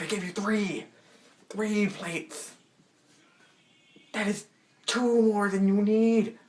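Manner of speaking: high-pitched exasperated mock yell; mad outburst. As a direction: blow up, pitch way up, drawing out the words. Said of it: "mad, right".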